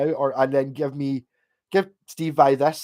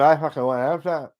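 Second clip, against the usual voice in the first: slurred Scots. Retold or imitated. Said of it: "but not just going".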